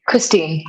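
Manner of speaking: "Christine" ending firmly on the n; name without A. Real, not acted. Guest correcting the host on her name, "Christine" with no A. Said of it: "no A".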